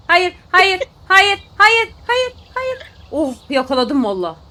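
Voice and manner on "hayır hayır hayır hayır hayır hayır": rising panic. Real, not acted. Panic rising over fish.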